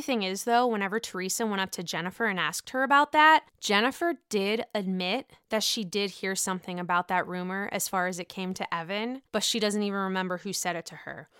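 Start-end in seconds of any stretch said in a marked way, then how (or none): none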